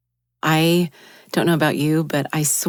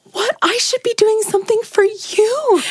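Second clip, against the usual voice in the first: high, loud stunned protest; stunned disbelief; 5 dB louder than usual. Retold or imitated. Protesting to the kind woman who paid, stunned and high. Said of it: "this face of like".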